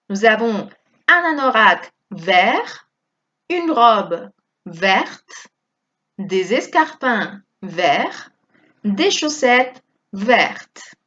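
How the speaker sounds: slow, with "vert" silent t and "vertes" t sounded; French pronunciation demo. Shown as a demonstration. Teacher drilling masculine and feminine "vert" for students, slowly. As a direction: model each phrase slowly and clearly. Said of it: "por eso aquí pronunciamos vert".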